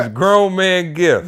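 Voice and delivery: slow emphatic catchphrase; emphatic callout. Real, not acted. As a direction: sell the code word, slow and loud. Repeats code word emphatically.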